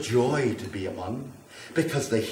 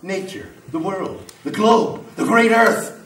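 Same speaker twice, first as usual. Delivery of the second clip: louder and higher, swelling with megalomania; grandiose ranting; 4 semitones higher, 7 dB louder than usual. Acted. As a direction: rant like a prophet of fire. Grandiose fire rant escalating.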